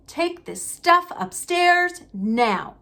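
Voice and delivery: slow, high curt command; snapping order. Acted. Uncle ordering Harry upstairs, slow and curt. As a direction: order it, no room to argue. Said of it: "snapped his uncle".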